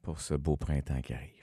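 chuchoté tout bas. Acted. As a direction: glisser ça en chuchotant. Souhait doux en aparté.

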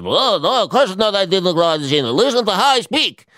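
hammed-up, high Israeli accent. Imagined Israeli grocer answering a passenger, exaggerated Israeli accent. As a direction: exclaim it in a caricatured Israeli accent. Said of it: "they were all Israeli grocers".

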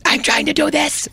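whisper yelling. Retold or imitated. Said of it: "We're trying to do that whisper yelling".

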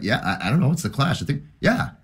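high, hesitant mock reply; lightly imitating a vague friend; unsure friend. Friend unsure answering about The Clash.

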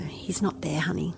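put-on whispered comfort to a child; quiet whispered reassurance to a daughter; soft reassuring whisper. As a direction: hush her gently, barely voiced. Mother reassuring daughter after search.

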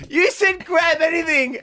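high, slow protest; retold yell. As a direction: re-enact yelling back in self-defence. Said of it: "I yell at you".

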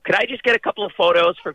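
high-pitched imitation of an American woman; American accent. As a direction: put on a high American accent, politely asking. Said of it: "and she's American".

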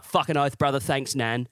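mock bogan mate pledging support; exaggerated Australian swearing mateship; broad Australian accent. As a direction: rattle it off as a loyal Aussie lout. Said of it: "think of the Australian accent".

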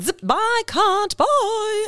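high silly voice of reason running away; reasoning fleeing. Retold or imitated. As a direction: play your reason bailing out in a panic. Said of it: "reasoning is one of the first things to be like".

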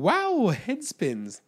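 slow, drawn-out wow; mock dazed wonder; dizzy wow. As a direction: let the head spin in the words. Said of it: "I was like".

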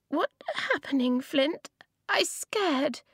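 put-on high childlike voice, fading to a scared whisper; a tiny girl peeking out, afraid; frightened little girl. Small child hiding under table.

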